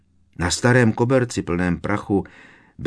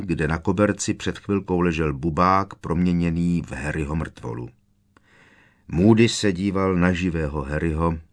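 ordinary delivery

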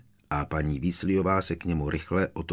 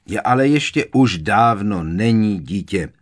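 louder impatient interruption; 3 semitones higher, 7 dB louder than usual. A narrator's reading. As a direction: cut her off, out of patience. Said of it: "přerušil ji netrpělivě Sirius".

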